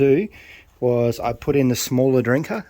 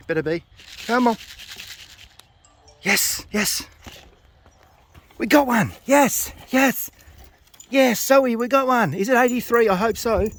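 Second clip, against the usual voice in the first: jubilant cheer; 11 semitones higher than usual. Real, not acted. Bird clocked in, cheering.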